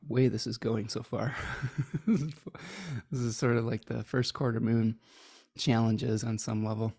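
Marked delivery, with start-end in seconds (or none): 0.1-2.2 laughing. Real, not acted. Apologizing for haphazard stream.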